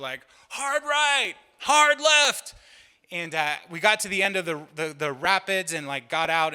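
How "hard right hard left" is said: high, loud shouted commands from the raft; yelled rafting commands. Retold or imitated. Youth pastor yelling steering calls at the kids. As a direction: bark the steering calls over the rapids. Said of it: "you yell out".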